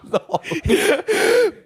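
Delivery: laughing through the words; can barely get it out for laughing. Real, not acted. Cracking up at the joke.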